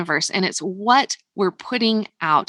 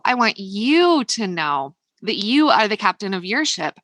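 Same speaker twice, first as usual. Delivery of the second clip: ordinary talk, nothing marked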